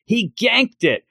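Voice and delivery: high-pitched mock yell; Iron Mouse yelling. Iron Mouse as the watch is grabbed, mock yelling. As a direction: cry it out high, without much extra volume. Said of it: "having Iron Mouse yelling".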